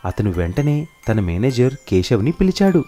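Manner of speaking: ordinary delivery, nothing marked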